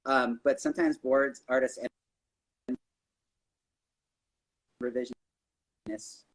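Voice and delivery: choppy audio cutting out; broken connection dropouts; corrupted glitchy audio. Real, not acted. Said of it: "Your audio is, is cutting out a little bit".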